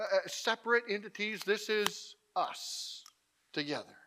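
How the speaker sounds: normal talking voice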